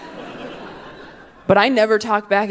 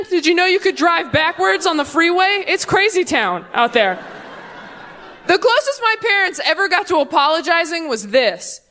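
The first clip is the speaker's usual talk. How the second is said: ordinary delivery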